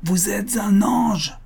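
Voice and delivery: high, slow, drawn-out call; crying out. A narrator's reading. Suitor calling out to the supposedly deaf aunt. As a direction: call it out word by word, pitched right up. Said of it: "cria-t-il".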